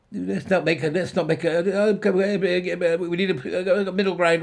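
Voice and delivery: loud, high exasperated plea; pleading loudly in frustration; exasperated shout. Real, not acted. Ranting at Keir Starmer, loud exasperated shout.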